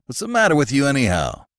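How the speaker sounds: a brusque warden losing patience; impatient. A narrator's reading. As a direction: warden snapping at the hysterical prisoner. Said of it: "insisted the warden impatiently".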